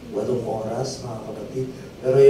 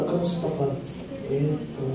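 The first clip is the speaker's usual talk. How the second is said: softer, listless mumbled answer; lifeless reply; 2 semitones lower, 9 dB quieter than usual. Shown as a demonstration. Preacher mocks a dull greeting reply, softer and listless. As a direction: answer like you have no life in you. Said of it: "Hindi yung pag kinausap ka".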